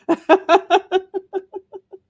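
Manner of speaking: high, loud burst of laughter; big laugh. Real, not acted. Laughing at a viewer's comment, a big high laugh. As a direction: let a real laugh burst out.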